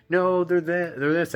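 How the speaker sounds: imitated high-pitched protest; defensive friend. Voicing women defending their friend.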